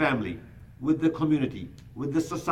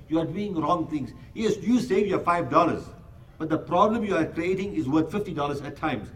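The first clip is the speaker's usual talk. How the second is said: ordinary talk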